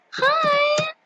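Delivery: high-pitched sweet hello; gushy greeting. Acted. Player greeting a cute stranger in game, gushy. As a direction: squeal a friendly hi.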